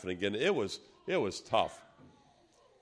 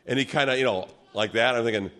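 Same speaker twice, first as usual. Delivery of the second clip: ordinary delivery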